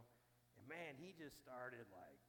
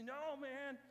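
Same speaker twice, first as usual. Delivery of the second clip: firm refusal; 11 semitones higher, 9 dB louder than usual. Retold or imitated. Buddy refuses again.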